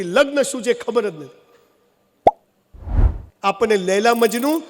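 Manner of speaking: normal talking voice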